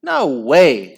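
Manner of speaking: loud, emphatic denial; shout. A narrator's reading. Henry denying the handball, shouting. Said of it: "shouted Henry".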